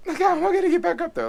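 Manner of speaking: squeaky high laugh through the words, fast; panicked laugh. Retold or imitated. Retelling being stuck below the snake, high panicked laugh.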